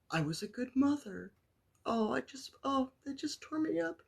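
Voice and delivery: fighting back tears as she quotes mom; choked-up retelling of a loving list; tearful. Real, not acted. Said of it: "I'm gonna start crying now".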